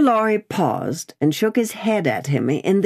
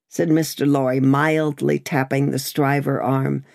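usual speaking voice

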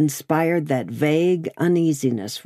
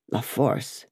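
low, softened repetition; hushed echo; 5 semitones lower, 6 dB quieter than usual. A narrator's reading. Lorry echoing the dreaded prison name, hushed. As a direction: repeat the name low and soft, taking it in.

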